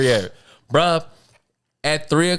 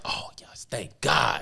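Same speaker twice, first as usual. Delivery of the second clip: whispered relief; 5 semitones lower, 8 dB quieter, slower than usual. Retold or imitated. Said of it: "I'm like".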